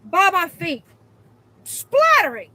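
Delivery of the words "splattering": high, loud repeat; appalled emphasis. Real, not acted. Repeating the gross detail, high and loud. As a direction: stress it in disgust. Outraged repetition.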